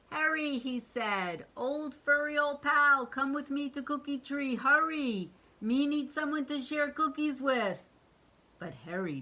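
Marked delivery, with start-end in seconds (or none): 0.1-7.8 monster calling. Acted. Monster calls friend.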